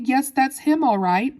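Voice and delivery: high, lively confirmation; excited yell. A narrator's reading. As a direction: call it out eagerly. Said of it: "yelled Mister Wonka".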